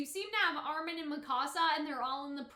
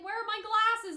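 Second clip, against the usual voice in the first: mock delirious plea; 7 semitones higher, 7 dB louder than usual. Delirious Zeke asking for glasses.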